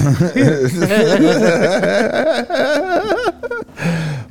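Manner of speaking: high, loud burst of laughter. Real, not acted. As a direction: crack up hard at the joke. Laughing at friend's joke.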